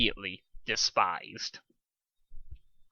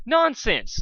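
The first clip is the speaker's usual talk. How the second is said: nonsense; 13 semitones higher, 5 dB louder than usual. Acted. Dust dismissing Spike, high.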